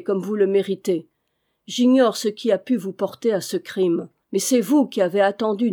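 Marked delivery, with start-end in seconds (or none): none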